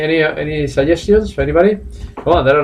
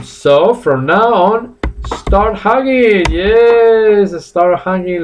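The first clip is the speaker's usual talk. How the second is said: slow, emphatic urging; 6 semitones higher, much slower than usual. Shown as a demonstration. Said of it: "teacher urging class".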